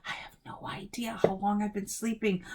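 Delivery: hushed, unvoiced whisper. A narrator's reading. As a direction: whisper it to yourself, just woken. Boy just woken, alarmed.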